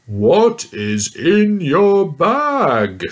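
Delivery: put-on mean giant yelling at the little girl. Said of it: "yelled the mean giant".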